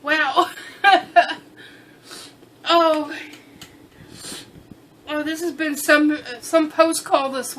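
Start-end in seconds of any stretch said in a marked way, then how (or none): 0.6-1.4 tearful laugh. Real, not acted. Laughing after crying.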